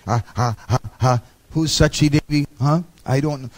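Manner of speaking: nervous laugh. Retold or imitated. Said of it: "the king was shaking".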